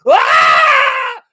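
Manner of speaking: full-force re-enacted scream; high fearful scream. Re-enacting tripping on something in the dark, terrified scream. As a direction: let out a very high, loud shriek of fright. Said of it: "the fear just cro-, like, was oozing".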